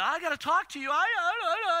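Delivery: high, loud nervous stammer; hammed-up flustered babble; mock mumbling. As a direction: stumble over the words like a tongue-tied son. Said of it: "I'm just mumbling".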